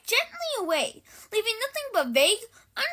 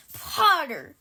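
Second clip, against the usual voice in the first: gruff growl; 4 dB louder than usual. A narrator's reading. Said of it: "Moody growled".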